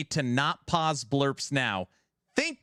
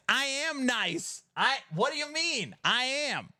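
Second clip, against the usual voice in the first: defensive squeak; 11 semitones higher than usual. Real, not acted. Defensive after being told be nice.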